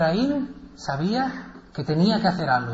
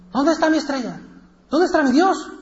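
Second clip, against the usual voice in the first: much higher, louder desperate question; anguished cry; 10 semitones higher, 4 dB louder than usual. Acted. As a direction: call out for the vanished god. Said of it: "Entonces Ibrahim gritó". (grito angustiado, mucho más agudo)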